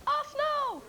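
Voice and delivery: [name]-like squeal. Real, not acted. Contestant knows the football answer, high excited squeal. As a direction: blurt it out in a very high voice. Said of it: "doing a [name] impression".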